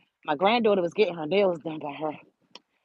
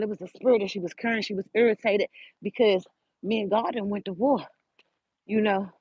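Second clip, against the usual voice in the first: usual speaking voice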